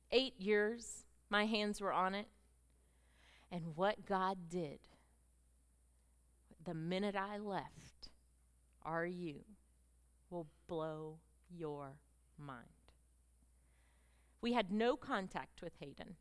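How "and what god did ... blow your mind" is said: slow, dropping to near silence; hushed build. Acted. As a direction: draw them in with a hush. Preacher builds suspense, hushing.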